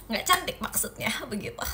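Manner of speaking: a sly unvoiced whisper, fading out; whispered tease. Real, not acted. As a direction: whisper the punchline as a cheeky aside. Teasing aside after compliment. (bisikan menggoda)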